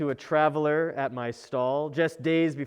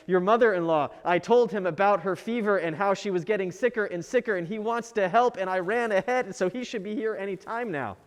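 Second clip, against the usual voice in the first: rushed, higher, breathless rattled-off news; all in one breath; 4 semitones higher, faster than usual. Acted. Brother explaining the visit, rushed and breathless. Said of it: "all in one breath again".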